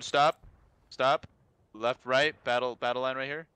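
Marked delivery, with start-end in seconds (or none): 0.0-1.3 sharp shouted halt; shouting. Real, not acted. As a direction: yell the halt order.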